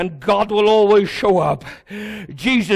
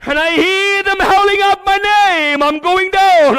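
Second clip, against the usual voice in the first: higher, louder cry to God; desperate prayer; 7 semitones higher, 4 dB louder than usual. Retold or imitated. Believer cries out to God from the storm, loud and high. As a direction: plead like someone sinking. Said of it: "You say".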